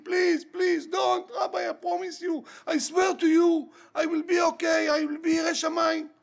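high, impassioned retold pleading; tearful begging. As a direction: plead desperately, promise after promise. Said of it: "I begged him".

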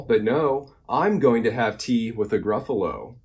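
lively, singsong picture-book reading; animated storytime narration; reading to children. A narrator's reading. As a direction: read the rhyme with big bouncy emphasis. Reads a rhyming picture book.

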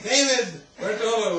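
high, loud call across a field; retold yell. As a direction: call out to the horse. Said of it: "They were screaming at the horse".

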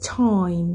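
"Time" with AI and EE merged quickly; "Time" as one gliding syllable, TAH-eem; English time. Shown as a demonstration. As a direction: say "Time" with a full glide before M. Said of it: "อิร่วมกันอย่างรวดเร็ว".

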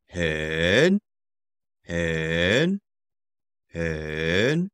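slow third-tone "hen". Shown as a demonstration. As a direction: dip "hen" down and rise, slow. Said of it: "Very is also third tone".

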